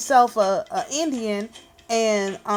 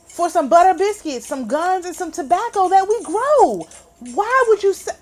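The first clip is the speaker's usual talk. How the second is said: raised, higher-pitched outraged disbelief; real anger; 6 semitones higher, 4 dB louder than usual. Real, not acted. Speaker protesting a bad land trade, real anger. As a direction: push it up in indignant protest. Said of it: "you can hear the anger in my voice".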